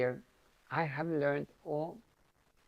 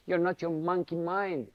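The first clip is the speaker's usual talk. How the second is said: ordinary talk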